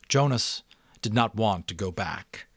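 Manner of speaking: expressive read-aloud narration; story narration. A narrator's reading. Narrator reading The Giver to subscribers, expressive storytelling.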